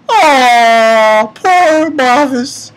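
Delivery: high, drawn-out "aww" of sympathy; mock pity. Real, not acted. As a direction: coo with exaggerated pity, slowly. Mock pity for crying Mavis.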